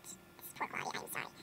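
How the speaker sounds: very soft, apologetic aside; whispered apology. Real, not acted. Apologetic aside about the video.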